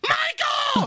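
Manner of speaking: very high mock scream; frantic shout. Stewardess yells for Michael, frantic shout. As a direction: imitate a shrieking call for help.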